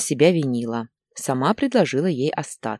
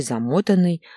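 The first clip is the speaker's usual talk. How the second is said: plain reading voice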